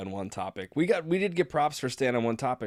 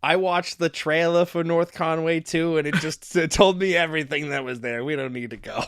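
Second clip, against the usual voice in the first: mock wife; 6 semitones higher, 5 dB louder than usual. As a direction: play the wife dismissing the sequel. Voicing wife's reply.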